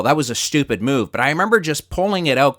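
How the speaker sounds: normal talking voice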